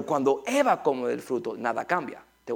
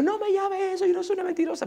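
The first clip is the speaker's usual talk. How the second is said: very high mock-indignant voice; imitated indignant grandmother; faster than usual. Grandmother protesting to her grandson, high mock indignation.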